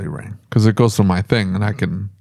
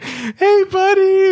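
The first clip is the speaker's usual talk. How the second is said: mock sugary greeting, pitched way up; gushing buddy greeting; much slower than usual. Manager mimicking the boss greeting a late employee, gushing. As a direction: coo it high like greeting a little pet. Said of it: "he's gonna be like".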